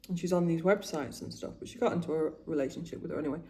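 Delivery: usual speaking voice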